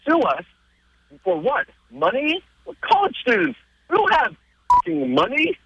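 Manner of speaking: high, rising disbelief building to a swearing punchline; mock the lawyers' threat as absurd; incredulous outburst. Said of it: "He's like".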